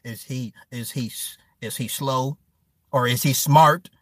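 hesitant, hushed and low impression of a worried father; halting, soft low question, stumbling on the first words; Forrest asking about his son. Forrest asking Jenny about the boy, hesitant. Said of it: "'Cause he was like".

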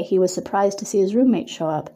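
plain reading voice

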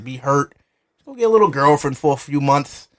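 ordinary talk

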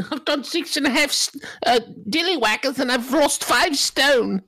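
bad British accent. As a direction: laugh through a terrible British accent. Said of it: "do it terribly".

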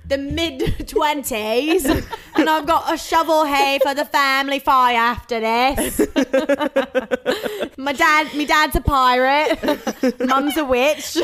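hammed-up British accent, breaking into laughter; mock British accent.

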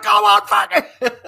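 high, loud, slowed exasperated outburst; exploding. Retold or imitated. Sergeant exploding at a detective at a crime scene, high exasperated yell. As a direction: blow up, dragging it out. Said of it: "That's when I would explode".

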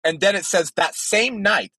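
normal talking voice

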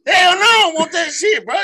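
very high, loud acted yell; outraged refusal. Storyteller turning down offered drugs, hammed-up shouted refusal. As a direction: holler the refusal in comic alarm, pitch way up.

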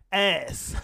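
"Ass" as a loud, standalone verdict; emphatic ASS. Real, not acted. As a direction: punch out "Ass" as one word. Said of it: "Why you say it like that?".

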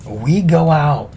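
normal talking voice

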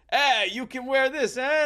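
high, loud French voice; Jacques voice. Retold or imitated. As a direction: offer it grandly, Frenchman style.

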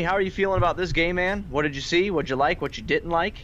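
ordinary delivery

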